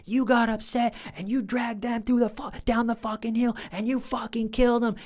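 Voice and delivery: high-pitched ranting interrogator; imitated screaming. Interrogator accuses suspect of killing them, imitated screaming. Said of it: "he's screaming".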